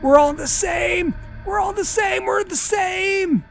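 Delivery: high, shouted pleas; terrified screaming; frantic begging. Acted. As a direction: beg frantically for life. Victim begging in panic.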